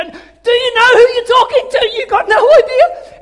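high, loud, half-laughing yell at the screen; mock outraged yell. Viewer yelling at a contestant on TV, hammed-up high shout. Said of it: "wanting to reach through the screen and go".

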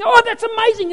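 high, loud wonder; amazed cheer. Retold or imitated. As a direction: gasp it out in delight. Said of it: "they're cheering and clapping".

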